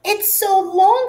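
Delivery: high, mocking jeer; high, teasing classmate voice. A narrator's reading. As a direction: taunt her about the long name. Classmate teasing at roll call.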